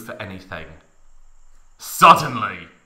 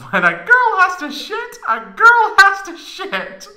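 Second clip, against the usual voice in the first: very high falsetto, louder, mocking; squeaky girly Tifa; 9 dB louder than usual. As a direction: ham up a prissy girl's proverb. Reader voicing Tifa's line.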